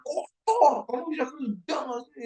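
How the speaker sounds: a hint of a bossy staffer scolding the office boy; mock scolding.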